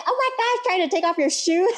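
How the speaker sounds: high-pitched exasperated mock protest; flustered traveler. Voicing a flustered traveler being yelled at.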